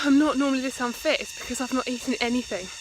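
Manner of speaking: panting from walking uphill; breathless after a climb; out of breath. Real, not acted. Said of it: "I'm out of breath".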